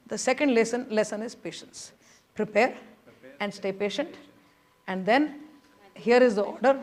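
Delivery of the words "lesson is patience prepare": normal talking voice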